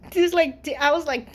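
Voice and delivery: usual speaking voice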